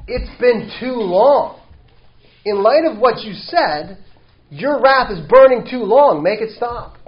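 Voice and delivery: high, loud, slow plea; pleading preaching. Acted. Preacher voicing the people's plea to God, high and loud. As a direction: beg for the wrath to end.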